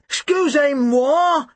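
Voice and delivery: high, drawn-out burble in French; burbling. A narrator's reading. Tim to the waiter after the crash, burbling. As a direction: burble it out slowly, pitched well up. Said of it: "Tim burbled".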